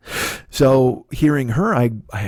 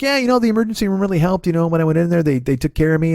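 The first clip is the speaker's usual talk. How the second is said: higher, quicker mom voice; imitating mom; 6 semitones higher, 3 dB louder, faster than usual. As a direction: rattle it off high like his breezy mom. Mom voiced telling ER story.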